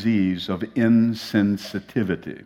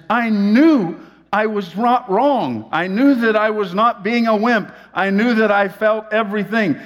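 emphatic insisting; 12 semitones higher, 5 dB louder than usual. Retold or imitated.

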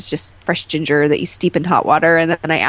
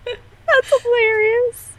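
high, slow, laughing through it; real laugh breaking into the words; much slower than usual. Real, not acted. Laughing at the tofu nickname.

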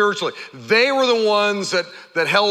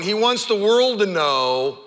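ordinary delivery

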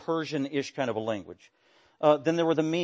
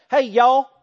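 uncouth Galilean twang; 8 semitones higher, 8 dB louder than usual. Acted. Preacher mimicking how Galileans spoke, uncouth twang. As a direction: holler it in a mock country twang. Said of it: "uncouth, unsophisticated twang".

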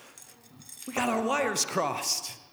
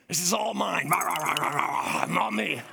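growly greedy monster, a touch lower, munching; Cookie Monster; 4 semitones lower than usual. Retold or imitated. Preacher mimics a greedy monster grabbing blessings. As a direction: gobble it up like a cookie-hungry monster. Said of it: "We're like Cookie Monster".

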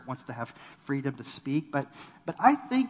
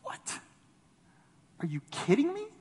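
incredulous; 11 semitones higher than usual. Retold or imitated. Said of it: "you want to say".

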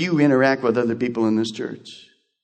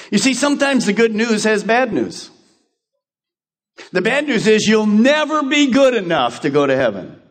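higher, weighty sermon warning; preaching; 7 semitones higher than usual. Acted. Pastor warns the church of bad news, preaching firmly.

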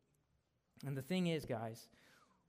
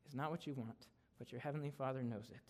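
normal talking voice

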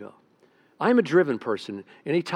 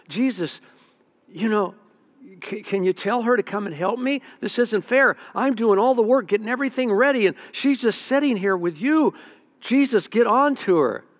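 higher, louder indignant complaint; annoyed Martha; 5 semitones higher, 3 dB louder than usual. Acted. Martha complaining to Jesus about her sister. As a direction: whine at Jesus that it isn't fair. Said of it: "Martha gets kind of angry and comes out and says".

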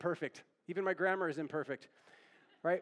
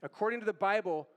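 ordinary talk

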